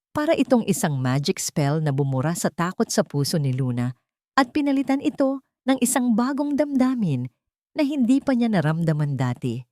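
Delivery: normal talking voice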